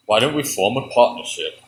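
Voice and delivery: the bird proposes a deal in a low voice; deep bird voice. Acted. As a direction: drop the bird way down. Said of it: "now the bird sounds like that?".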